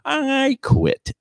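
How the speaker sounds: put-on high voice quoting a young woman. Voicing the young teacher.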